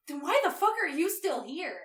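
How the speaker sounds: mock-exasperated yell, climbing high and loud; exasperated outburst. Chase demands to know why he's still here, exasperated. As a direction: act out a fed-up demand, pitch shooting up.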